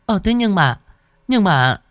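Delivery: high-pitched, faltering protest; stammering maid. A narrator's reading. Maid trying to object to her mistress, stammering high. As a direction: stumble over the words in a high voice. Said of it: "con Mận lắp bắp định nói gì đó".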